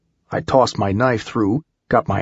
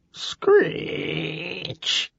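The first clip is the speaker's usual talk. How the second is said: high, soft, stretched-out screech; imitated screech; 7 semitones higher, 6 dB quieter than usual. A narrator's reading. Narrator voicing the sound nearing in the shaft, screeching. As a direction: draw it out high like scraping metal.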